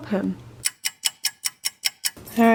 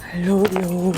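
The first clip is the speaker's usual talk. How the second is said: usual speaking voice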